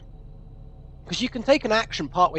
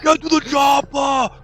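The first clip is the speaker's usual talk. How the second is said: put-on slow shouted Austrian accent; 5 semitones higher than usual. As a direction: yell it slowly like Arnold in an Austrian accent. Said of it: "as played by Arnold Schwarzenegger".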